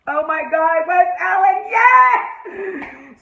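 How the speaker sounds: high, loud gushing that climbs; ecstatic fan shout. Real, not acted. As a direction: build to a shrieked final word. Fan gushing over the couple.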